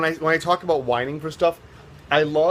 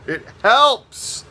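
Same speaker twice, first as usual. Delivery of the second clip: excited exclaim; 9 semitones higher, 7 dB louder than usual. Real, not acted. Exclaims joke about whining.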